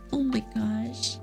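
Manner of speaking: ordinary talk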